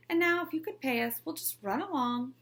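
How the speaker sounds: high, fast eager ask; keen to grab the money and go; hurried sly request. A narrator's reading. Tailor asking the principal for pay, fast and high. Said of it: "said Ivy".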